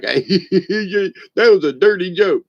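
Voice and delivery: laughing through the words, slow. Real, not acted. Teller laughing at his own dirty joke. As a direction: chuckle out the punchline verdict.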